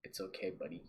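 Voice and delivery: very soft, low consoling voice; hushed mock-consoling comfort; gentle reassurance. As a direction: tenderly reassure a buddy, leaving pauses. Consoling the friend via the video.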